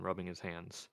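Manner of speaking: usual speaking voice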